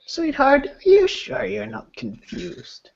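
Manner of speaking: high, patronizing granny voice; high sugary old lady; condescending old woman. Acted. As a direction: talk down to her sweetly.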